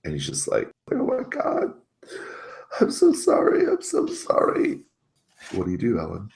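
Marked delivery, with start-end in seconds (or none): 0.9-4.8 very high, breaking sobbed apology; terrified bawling. Acted. Delivery guy turning away in tears, bawling and terrified. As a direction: bawl it out in a tiny high wail. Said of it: "he just begins bawling, terrified and upset".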